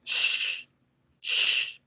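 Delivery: SH, long unvoiced shushing; sonido del silencio. Shown as a demonstration. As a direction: hiss SH as if asking for silence. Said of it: "el sonido del silencio". (SH largo, como pidiendo silencio)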